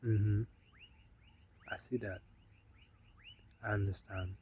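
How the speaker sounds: soft, unreactive listening replies; low-key, softly spoken, spaced-out replies; calm acknowledgement. Retold or imitated. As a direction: let it wash over you, speaking quietly. Retold unreactive reply to angry woman.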